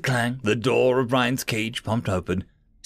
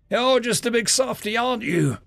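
petting voice; 9 semitones higher than usual. A narrator's reading. Talking sweetly to a dog.

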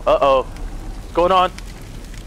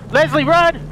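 urgent call; 9 semitones higher than usual. Real, not acted. Rushing to save companion from zombie.

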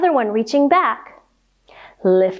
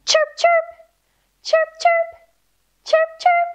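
very high chirping bird; squeaky high bird chirps. Pretend. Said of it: "Flap your wings and say".

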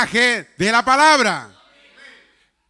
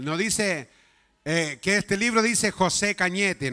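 ordinary talk, nothing marked

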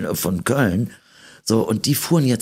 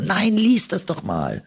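high, slow pleading voice of the producers; mock begging, pitched way up; insistent high mock urging; 12 semitones higher than usual. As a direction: whine it like a pushy producer. Producers pushing again.

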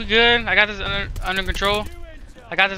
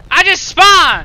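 loud, high shout of protest; outraged gamer shout; exasperated yell; 9 semitones higher, 10 dB louder, much slower than usual. Real, not acted. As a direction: yell it in disbelief at an unfair death. Killed right after spawning.

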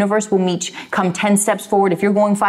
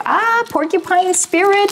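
much higher, slow delighted "Ah" at the card; delighted surprise; 12 semitones higher, slower than usual. Real, not acted. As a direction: greet the card with a bright gasp of delight. Card revealed in tarot reading.

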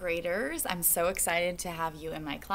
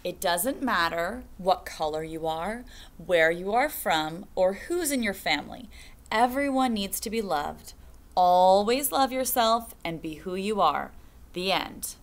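put-on reading to children; 6 dB louder than usual. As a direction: stretch each line out for the kids. Reads picture book to class.

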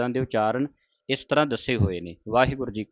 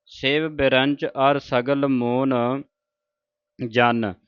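slow recitation with a pause after ਜਨ; much slower than usual. Shown as a demonstration. Teacher shows the sangat where to pause. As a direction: recite slowly and rest on ਜਨ. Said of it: "ਜਨ 'ਤੇ ਵਿਸਰਾਮ ਲਾਉਣਾ ਹੈ".

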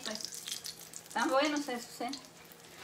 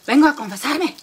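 loud mock-aggressive announcement; forceful, louder angry man; 8 dB louder than usual. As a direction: demand confession with acted anger. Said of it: "bien enojado".

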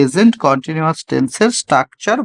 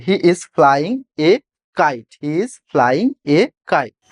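slow dictation; much slower than usual. Shown as a demonstration. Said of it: "সে একটি ঘুড়ি ওড়াচ্ছে".

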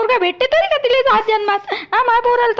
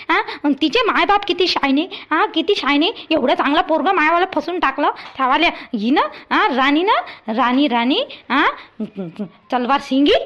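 contemptuous sneer; 8 semitones lower than usual. Acted. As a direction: spit out her name with scorn. Mother-in-law insults bride. (तिरस्काराने टोमणा, खालच्या आवाजात)

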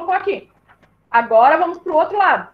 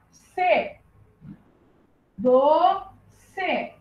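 "Do, C" as two held syllables, the last softer; 5 dB quieter, much slower than usual. Shown as a demonstration. Teacher putting two syllables together, "Do, C" syllable by syllable. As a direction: pause between "Do" and a softer "C". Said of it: "Que sílaba era?".

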